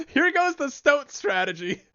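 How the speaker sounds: excited shout. Real, not acted. Gamer thrilled his stoat combo works, high gleeful shout. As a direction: yell it out in delight.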